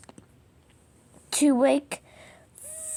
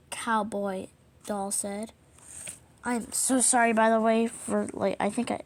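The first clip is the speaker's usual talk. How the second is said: plain reading voice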